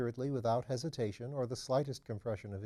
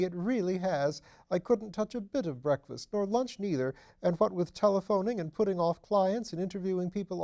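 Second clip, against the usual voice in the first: higher, louder fussy nervous voice; nervous little man; 7 semitones higher, 6 dB louder than usual. A narrator's reading. Nervous Phipps fussing to his lordship, higher and louder. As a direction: fret anxiously up in the voice.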